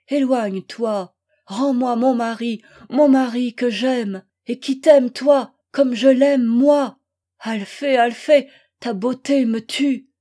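impassioned, wounded outpouring; anguished pleading. Acted. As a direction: beg a rival in mounting anguish. Rival begs for her husband back.

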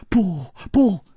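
high, frightened protest; desperate refusal. A narrator's reading. Girl refusing to look at the corpse, desperately. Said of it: "拼命地摇着头说".

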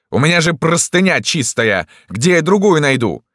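high-pitched fussy complaint; indignant protest. A narrator's reading. Doctor protecting his clean sheet, indignant.

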